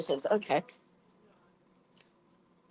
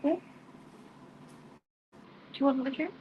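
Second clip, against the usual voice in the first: higher, sing-song pet voice; 6 semitones higher, faster than usual. Real, not acted. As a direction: coo at the cat. Coaxing her cat onto chair.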